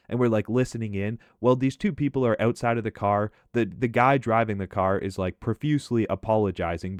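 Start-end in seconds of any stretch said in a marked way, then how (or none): none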